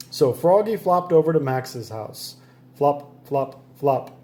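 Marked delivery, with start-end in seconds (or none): none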